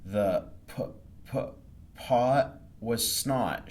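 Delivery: halting, stuttering read; slow, stammering first-grade reader; nervous stammer. Acted. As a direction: stumble over each word nervously. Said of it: "laughed at her mistake".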